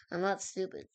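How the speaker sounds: ordinary delivery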